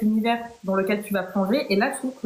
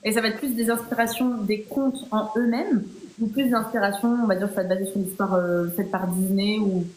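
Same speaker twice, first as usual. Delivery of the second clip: ordinary talk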